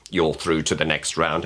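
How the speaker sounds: low, monotone judge; deadpan judge impression; Simon Cowell. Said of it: "impersonations of Simon Cowell".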